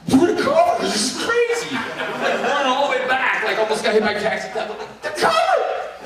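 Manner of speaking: high, loud and laughing; giddy yelling all the way back; ecstatic. Retold or imitated. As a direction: shout it with joy as you run.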